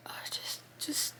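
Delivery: breathless whisper, words failing; a broken whispered stammer; tearful whisper. Real, not acted. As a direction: whisper as the tears catch your throat. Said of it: "trying to compose myself".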